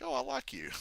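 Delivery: high, soft imitation of a pleased wrestler; mock delighted realization. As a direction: go up high and light. Voices Cena warming to Punk.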